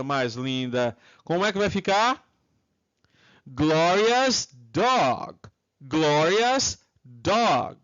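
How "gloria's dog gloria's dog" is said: drawn-out, careful teacher's model; slow, stretched-out English answer; slow model pronunciation. Shown as a demonstration. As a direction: say it slowly for students to copy.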